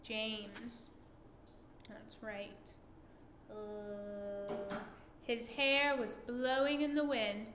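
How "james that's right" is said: plain reading voice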